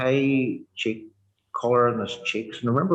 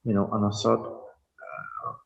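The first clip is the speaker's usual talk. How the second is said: ordinary talk, nothing marked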